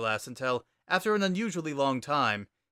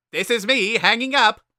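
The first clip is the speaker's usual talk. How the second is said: high, loud, booming skeleton brother; loud high cartoon brother voice; Papyrus voice; 10 semitones higher, 10 dB louder than usual. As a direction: bellow it out like an eager kid brother.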